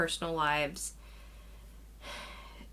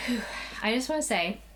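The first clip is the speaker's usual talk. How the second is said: out of breath; 3 semitones higher, faster than usual. Real, not acted. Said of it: "if I sound a little bit out of breath".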